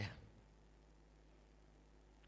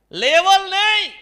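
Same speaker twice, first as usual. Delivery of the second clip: much higher and louder, slow and drawn out; preaching shout; 15 dB louder, slower than usual. Real, not acted. Preacher to the congregation, slow full-force shout. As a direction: cry it out slowly with full force.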